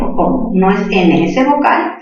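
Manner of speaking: ordinary talk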